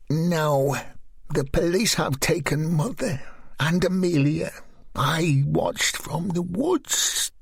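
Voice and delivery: flat and strange; Katrina's voice. Acted.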